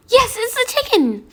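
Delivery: high, loud delighted cry; thrilled the dish arrived; excited. Real, not acted. Chicken arrives.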